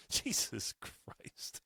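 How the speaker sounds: breathless, slow whispered "Jesus Christ"; muttered disbelief in a whisper; whispered exasperation. Real, not acted. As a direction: let it out under your breath, slowly. Exasperated aside.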